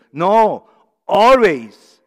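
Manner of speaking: "always" loud and high, falling hard; emphatic correction. Acted. Pastor correcting himself before the church, emphatic. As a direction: hammer "always" home as the answer.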